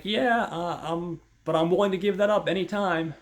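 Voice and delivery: high, loud smooth-talking answer; high eager reply. Retold or imitated. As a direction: sell it to her, high and loud. Steve answering Mary's tease.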